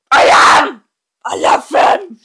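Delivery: gleeful confession. Real, not acted. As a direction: blurt out the love for Mentos. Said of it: "Not that I'm addicted to Mentos".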